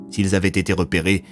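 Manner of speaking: usual speaking voice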